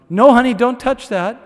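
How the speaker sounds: put-on calling out to stop the child; scolding a toddler. Dad warns his toddler daughter off the stereo, loud. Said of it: "the same moment with my daughter".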